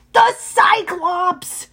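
drawn-out exasperated satyr voice; slow, fed-up high bleat. A narrator's reading. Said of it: "Grover said, exasperated".

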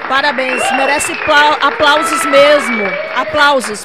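loud, enthusiastic praise; excited cheering. Real, not acted. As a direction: cheer her on loudly. Host cheering a guest's work.

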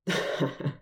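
short real chuckle; amused laugh at the memory. Real, not acted. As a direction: let a small laugh slip out. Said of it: "I'm starting to laugh".

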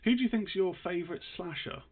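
usual speaking voice

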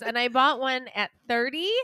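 normal talking voice

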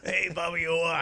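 slow, lightly voiced Boston accent. Shown as a demonstration. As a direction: greet Bobby Orr like a Boston local. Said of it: "the most Boston sports".

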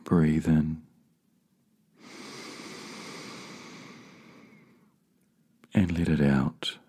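slow, measured meditation guide with long pauses; spacious, calming delivery, letting each phrase settle; guided meditation. Real, not acted. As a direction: lead them gently inward, unhurried.

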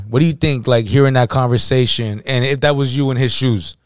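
ordinary delivery

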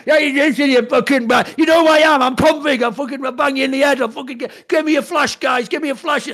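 put-on loud, high drunken rant, yelling and bragging; drunk shouting. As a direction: bellow it like a drunk throwing his weight around. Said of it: "In reality, you're just".

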